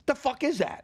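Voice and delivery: high, loud, outraged question; appalled professor. Retold or imitated. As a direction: imitate an aghast teacher demanding an explanation.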